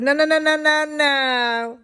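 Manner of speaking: high lilting no no no; teasing the boy with a high sing-song refusal. Retold or imitated. Teacher refusing the boy, high sing-song voice.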